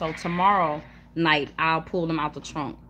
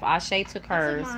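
ordinary delivery

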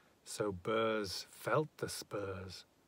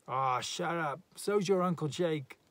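raised, scornful comeback; jeering retort; 5 semitones higher, 5 dB louder than usual. A narrator's reading. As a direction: shout back rudely, voice rising. Party heckles the neighbour back.

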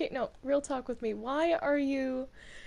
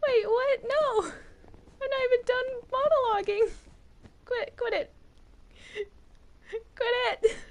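high loud outraged cry; outraged protest; 11 semitones higher, 6 dB louder than usual. Acted. Protest at being exorcised.

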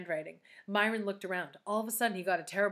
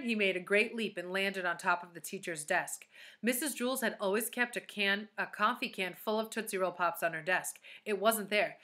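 ordinary delivery, nothing marked

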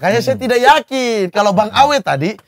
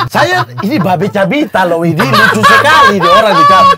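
high, loud laughing talk; cracking up recalling Babe; 11 semitones higher, 5 dB louder than usual. Real, not acted. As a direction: break up laughing mid-sentence. Laughing at Babe's voice.

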